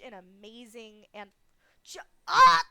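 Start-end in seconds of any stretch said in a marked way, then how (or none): 1.8-2.6 sudden loud high cry of shock; startled yelp. Real, not acted. Streamer startled by a sudden stream alert, yelping. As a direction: jump out of your skin mid-word.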